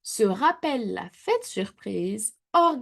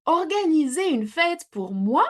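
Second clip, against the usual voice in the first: higher, louder amazed cry; surprised exclamation; 8 semitones higher, 4 dB louder than usual. A narrator's reading. The wolf hearing of a party for him, exclaiming in surprise. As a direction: exclaim in disbelief. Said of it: "s'écrie le grand méchant loup".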